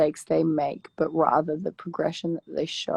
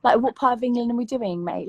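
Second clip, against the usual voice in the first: put-on playful, higher-pitched British accent; 5 semitones higher than usual. Answering a dare to do British accents, playful British accent. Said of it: "do your best British accent".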